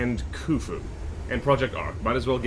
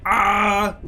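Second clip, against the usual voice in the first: a hammed-up scream while falling; mock falling yell; 7 semitones higher, 6 dB louder than usual. Imagining a chatter sliding off a building, mock yell. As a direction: yelp like slipping off a building. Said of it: "you're like".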